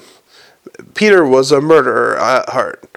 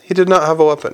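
usual speaking voice

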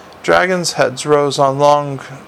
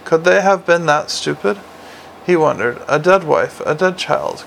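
usual speaking voice

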